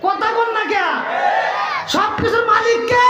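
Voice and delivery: calling out to crowd. Real, not acted. Preacher demanding the crowd answer who owns everything, high shout. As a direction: rouse the crowd to answer back.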